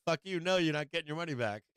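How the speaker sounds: mock-gleeful refusal; defiant refusal. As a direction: shut her down, loud and high. Refusing sister's refund.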